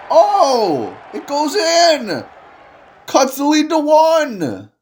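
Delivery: high, rising announcer shout; excited call. Acted. Commentator calls Farley's basket to viewers, high excited shout. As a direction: sell the basket like a thrilled commentator.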